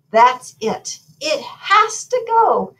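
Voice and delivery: high, emphatic queenly order; commanding. A narrator's reading. Queen orders the goat out, high commanding voice. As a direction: lay down the law, pitched high. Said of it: "commanded the queen".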